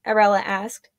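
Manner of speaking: ordinary delivery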